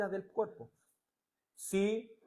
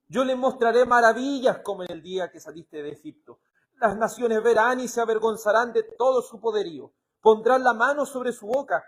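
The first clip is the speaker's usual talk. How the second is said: loud, raised-pitch scripture proclamation; lectura bíblica enérgica; 3 semitones higher, 6 dB louder than usual. Acted. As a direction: declaim the prophecy with force. Reads scripture at full voice. (proclamación bíblica en voz alta y enérgica)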